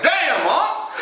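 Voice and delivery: very high, mock-shocked exclamation; astonished outcry. Nephew reacting to his uncle's exposed body, astonished outcry. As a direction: blurt it out in high, comic amazement.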